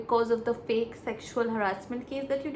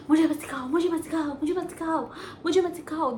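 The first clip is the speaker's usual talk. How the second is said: higher, repeated indignant snap; mock indignant; 5 semitones higher, faster than usual. Imitating Shilpa shutting down Akanksha, raised and huffy. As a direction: hammer it like a huffy refusal. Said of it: "She is like".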